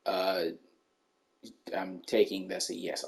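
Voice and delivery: low, quiet, lazy mumble of the friend; dopey mumbling dude. Retold or imitated. Imitating the clueless friend, low and mumbled. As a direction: mumble it like you barely care. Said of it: "He goes".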